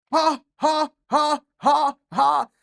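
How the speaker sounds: loud high laughter burst; ecstatic laugh. Real, not acted. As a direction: cackle with joy. Laughing after the goal.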